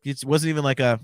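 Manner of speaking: usual speaking voice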